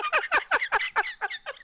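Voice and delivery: high-pitched laugh. Real, not acted. Laughing at Hogan era.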